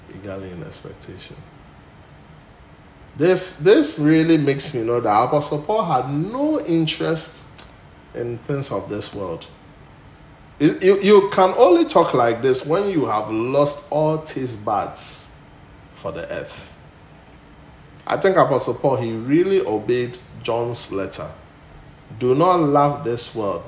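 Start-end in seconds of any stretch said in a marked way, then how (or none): 3.2-15.3 loud, soaring preacher's voice; full-force preaching. Acted. Pastor thunders to the church about Paul, loud preaching. 18.1-23.7 loud, soaring preacher's voice; full-force preaching. Acted. Pastor thunders to the church about Paul, loud preaching.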